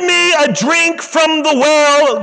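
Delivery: slow, high preaching that swells to a shout with a laugh; full-force preaching. Acted. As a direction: preach it out and let it build to a cry. Preacher quoting Jesus, rising.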